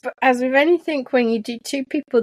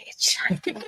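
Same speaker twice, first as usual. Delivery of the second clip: hushed, mesmerised whisper; mock whisper; 7 dB quieter, slower than usual. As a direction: breathe it as if hypnotised by the light. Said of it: "It's like".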